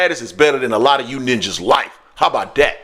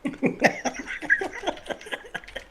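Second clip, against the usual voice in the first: soft airy laugh; breathy laugh; 12 dB quieter than usual. Real, not acted. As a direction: laugh under your breath. Laughing after demo.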